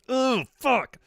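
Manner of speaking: high, cringing groan of revulsion; disgusted shudder. Real, not acted. Streamer recalling two rocks rubbed together, high cringing disgust. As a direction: recoil from it, pitch jumping up.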